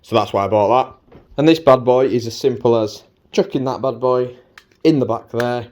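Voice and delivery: ordinary talk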